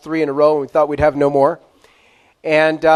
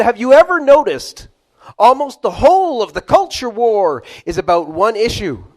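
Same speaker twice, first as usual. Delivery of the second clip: higher and louder quoted question, stress on "whole"; quoted "Have you ever noticed" raised high with "whole" leaned on; quoting Kreeft; 4 semitones higher, 3 dB louder than usual. Retold or imitated. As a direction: put the philosopher's question to the room with emphasis on "whole". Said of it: "And he said this".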